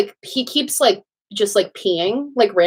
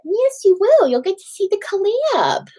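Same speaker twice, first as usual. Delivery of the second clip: high sing-song pet voice; 11 semitones higher than usual. Real, not acted. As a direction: coo it up at the puppy. Talking to the puppy.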